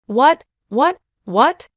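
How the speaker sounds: loud, high, rising repeated questions; shocked outbursts, each jumping upward; panicked disbelief. A narrator's reading. Brother learning who the boyfriend is, panicked shock. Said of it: "My brother was panicking".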